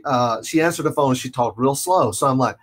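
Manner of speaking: ordinary talk, nothing marked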